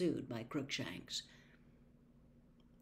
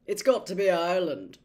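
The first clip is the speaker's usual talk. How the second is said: louder, thick words through a mouthful; mouth full; 10 dB louder than usual. A narrator's reading. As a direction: talk round a mouthful of potato. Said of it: "thickly through a mouthful of potato".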